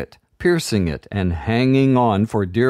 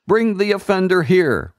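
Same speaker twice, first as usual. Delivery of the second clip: commanding; 10 semitones higher than usual. A narrator's reading. Enchanter ordering burners to fetch the girl. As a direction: order the guards. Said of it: "commanded the enchanter".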